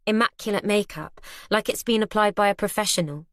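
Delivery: ordinary delivery, nothing marked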